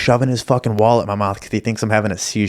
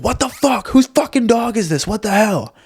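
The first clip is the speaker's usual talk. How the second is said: much higher, mock shrill outraged woman; an angry woman yelling about the dog that peed on her, much higher; angry lady; 13 semitones higher than usual. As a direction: imitate the pissed-on lady's outrage. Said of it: "she obviously gets angry and is like".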